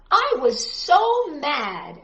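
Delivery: slow, stretched "so mad"; dramatic title reading. A narrator's reading. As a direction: draw out the title for the children. Reads book title dramatically.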